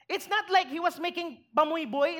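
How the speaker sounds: normal talking voice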